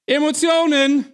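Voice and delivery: high, loud burst; sudden emotional shout. Pretend. Preacher breaks off reading about hammering the wheel, sudden shout. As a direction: belt it out in a comic outburst. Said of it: "ich versuch's jetzt nicht immer".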